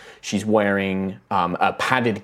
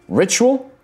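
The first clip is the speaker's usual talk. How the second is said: high, loud questioning echo; startled query; 6 dB louder than usual. Acted. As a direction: pounce on an overheard word. Man overhears a suspicious word.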